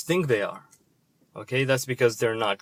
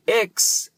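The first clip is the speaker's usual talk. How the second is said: "Ex" pitched high and loud, standing alone; emphatic prefix; 9 semitones higher, 5 dB louder than usual. Shown as a demonstration. Explaining the prefix to viewers, a high emphatic "Ex". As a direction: punch "Ex" as a separate syllable. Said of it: "the prefix ex".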